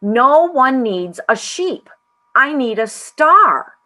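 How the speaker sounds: loud, high, very slow proclamation; booming, drawn-out declaration; thundering. A narrator's reading. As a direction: thunder it out, stretching every word. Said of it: "The voice thundered".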